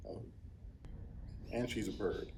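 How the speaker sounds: usual speaking voice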